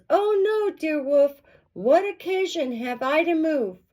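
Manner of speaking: put-on high, singsong girlish protest; innocent little girl. A narrator's reading. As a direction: plead innocence in a high lilt.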